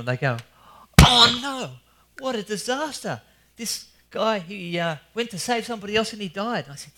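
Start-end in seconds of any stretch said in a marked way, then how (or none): 1.0-6.6 imitating a dismayed listener, higher and louder at first; mock distress at the hero's death; emotional. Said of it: "they're very emotional".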